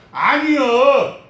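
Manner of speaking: slow, loud, high 아니요; 아니요, booming and drawn out; drawn-out emphatic no. Real, not acted. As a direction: stretch 아니요 out and hammer it. Preacher's emphatic denial.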